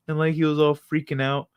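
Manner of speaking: normal talking voice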